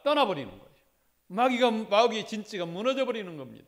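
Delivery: loud, driving pulpit preaching; fervent preaching. Acted. Pastor urging his church not to give in to the devil, fervent preaching. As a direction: press each phrase on the congregation.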